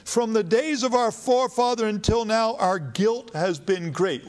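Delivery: high, ashamed plea to God; pleading. Acted. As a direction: beg with a lifted, breaking voice. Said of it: "he starts pleading with God".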